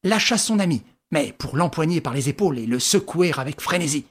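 usual speaking voice

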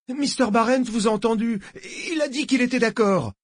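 very high, petulant whine; whining like a brat. A narrator's reading. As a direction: wail it like a spoiled child. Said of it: "aussi géniarde que celle d'un gosse capricieux". (geignard comme un gosse capricieux)

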